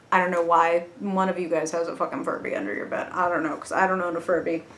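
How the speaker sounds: ordinary talk, nothing marked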